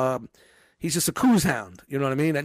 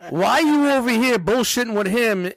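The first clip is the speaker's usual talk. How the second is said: mock scolding; 6 semitones higher, 4 dB louder than usual. Boss mimics a manager yelling at idle kids, louder. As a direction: chew out the slacker. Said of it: "imitating a stern manager".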